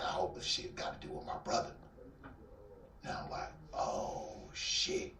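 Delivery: soft near-whisper, sinking feeling; hushed dread. Retold or imitated. Hoping the news is not his brother, hushed dread. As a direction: mutter it as dread sets in.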